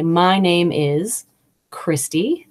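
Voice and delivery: each word slow and clear, My name is Christy. Shown as a demonstration. Teacher demonstrating her name for a new colleague, very slow and clear. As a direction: spell it out gently for a new colleague. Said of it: "try and say your name very slowly".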